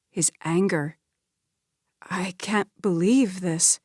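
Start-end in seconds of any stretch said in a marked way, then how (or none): 2.0-3.8 slow, whispered disbelief; sputtering. Acted. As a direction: whisper it, stunned. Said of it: "Beverly sputtered".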